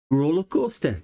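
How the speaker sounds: high, hammed-up Geordie catchphrase; badly faked Geordie, up high; mock Geordie accent. Shown as a demonstration. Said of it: "what you're supposed to say if you're from Geordie".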